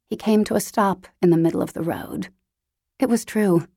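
ordinary delivery, nothing marked

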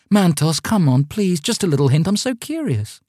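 put-on high, coaxing girl's plea; wheedling girl. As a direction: wheedle sweetly for a hint. Girl begs for a hint.

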